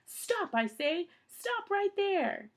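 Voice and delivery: slow, high, loud call. A narrator's reading. Mister Poe calling after the fugitives, slow loud call. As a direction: bellow it out slowly at a distance. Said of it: "and called out again".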